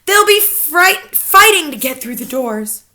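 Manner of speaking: lightly gloating witches. A narrator's reading. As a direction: gloat at the thought. Witch audience.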